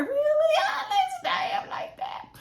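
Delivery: very high squealed reaction, then drawn out; mock disbelief. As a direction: act incredulous way up high, ending slowly. Acting out shocked reaction to Alex.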